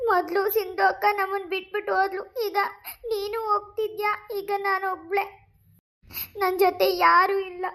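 tearful high voice, words breaking; crying. Acted. Younger sister crying as her elder sister leaves home. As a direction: sob through it as the sister leaves.

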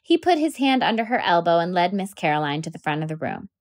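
usual speaking voice

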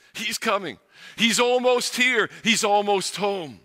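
high, ringing herald cry of joy; glad shouts. A narrator's reading. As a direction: call out the arrival like rejoicing angels. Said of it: "hear the glad shouts".

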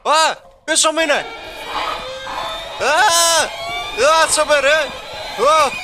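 real fright, shrieking at the monster; real panicked scream. Real, not acted. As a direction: scream like it's right behind you. Monster chasing him, jump scare.

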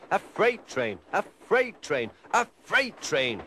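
high, rising grumble that slows at the end; grumbling Gordon. A narrator's reading. Gordon grumbling to himself about the freight train. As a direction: sulk over each repeat. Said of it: "he grumbled".

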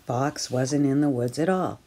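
plain reading voice

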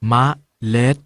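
มะ short, a break, then เล็ด; pronounced มะ-เล็ด. Shown as a demonstration. Teacher breaks เมล็ด into syllables for students. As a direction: split it as มะ ... เล็ด. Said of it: "ออกเสียงว่า".

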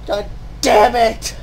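ordinary talk, nothing marked

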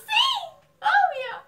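very high, loud squeal; overjoyed yes. Retold or imitated. Dreamer asked to be [name]'s girlfriend, overjoyed shriek. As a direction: shriek the answer with delight.

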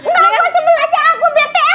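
high, sulky words through giggles; laughing pout. Acted. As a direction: complain but can't stop laughing. Pouting while laughing.

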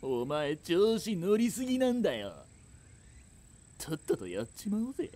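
high, sneering distant threat; threatening bully. Acted. Bully urges the gang to attack her, high sneer. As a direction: egg the others on to hurt her.

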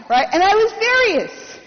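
ordinary delivery

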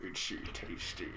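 eerie, breathless whisper; creepy whisper. Pretend. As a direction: whisper it like a creepy zombie note. Creepy zombie diary line whispered.

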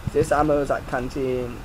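usual speaking voice

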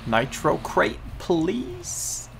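pleading. Real, not acted. Begging the game for a crate.

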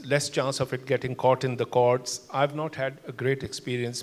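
ordinary talk

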